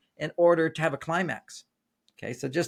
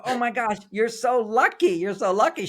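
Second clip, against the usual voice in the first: much higher, louder mock squeal of envy; co-hosts squealing at the guest's luck; envious gushing; 7 semitones higher, 4 dB louder than usual. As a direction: squeal it like starstruck fans. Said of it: "And they all go".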